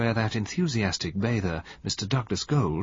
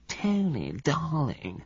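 mocking Valentine's coo; 6 semitones higher, 3 dB quieter, slower than usual. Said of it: "She can't do anything but say".

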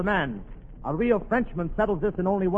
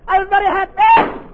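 much higher and louder, slowing on very happy; overjoyed; 13 semitones higher, 9 dB louder than usual. Acted. Boyer after kissing Paulette, giddy and loud. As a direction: burst with giddy joy.

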